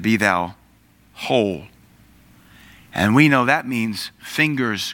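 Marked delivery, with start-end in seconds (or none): 0.0-1.7 low gentle blessing. Acted. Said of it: "And the Lord said".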